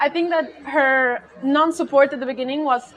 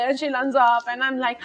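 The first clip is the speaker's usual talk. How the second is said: normal talking voice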